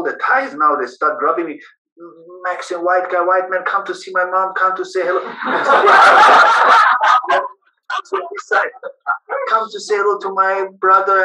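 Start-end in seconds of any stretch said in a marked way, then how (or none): 2.4-5.2 high, loud, pushy calls; eager Thai visitors. Retold or imitated. Thai visitors pulling him over. 5.3-7.5 high, loud, breathless laugh; burst of laughter. Real, not acted. Laughing at the scene. 9.5-11.2 high, loud, pushy calls; eager Thai visitors. Retold or imitated. Thai visitors pulling him over.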